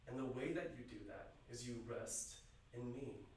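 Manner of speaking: soft, hushed reassurance; Jesus promising his followers rest, softly and tenderly. Retold or imitated. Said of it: "He is saying".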